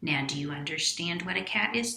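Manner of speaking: plain reading voice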